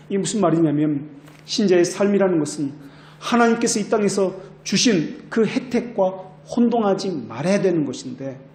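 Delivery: usual speaking voice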